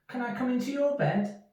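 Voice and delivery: high, pleading little mouse. Acted. Little Mouse asks Big Mouse to share his bed: high pleading voice. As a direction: beg sweetly in a tiny voice.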